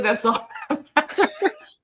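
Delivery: slow, laughing joke. Real, not acted. Joking mock advice.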